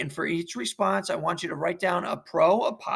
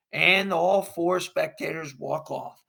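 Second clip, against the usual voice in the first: usual speaking voice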